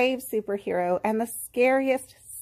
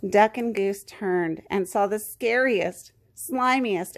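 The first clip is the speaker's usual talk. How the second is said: usual speaking voice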